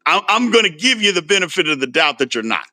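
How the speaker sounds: ordinary talk, nothing marked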